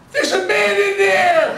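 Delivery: high, loud, drawn-out mock kid yell; imitated child's shout. Little boy announcing his discovery under the mask, high shout. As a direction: cry it out like an astonished little boy. Voicing the shocked little boy's cry.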